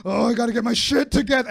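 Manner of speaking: hammed-up worry rising in the second go; anxious. Telling the crowd his panicked twenties self, mock-anxious. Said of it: "I was just like".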